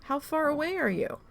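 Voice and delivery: high, startled question; a retold husband caught off guard. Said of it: "He goes".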